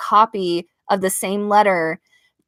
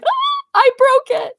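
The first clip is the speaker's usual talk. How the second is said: high, drawn-out mock wail; tearful wail; 4 dB louder, slower than usual. Retelling to viewers how a keepsake broke, tearful wail. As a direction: wail it in tearful dismay, slowly. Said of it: "in tears when it fell off".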